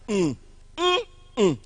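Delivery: teacher humming a middle-stressed word's rhythm; hummed stress pattern. Shown as a demonstration. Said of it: "just make the sound".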